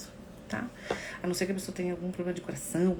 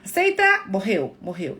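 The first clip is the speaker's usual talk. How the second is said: blunt mock condolence; 10 dB louder than usual. Mimicking someone who tells a mourner to accept it, loudly. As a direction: snap it out like a tactless relative.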